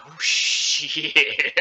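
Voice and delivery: long drawn-out mock shock; imitating a slow, stunned reaction; retold realization. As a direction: stretch it into a dawning oh-no. Retold shock on seeing the time.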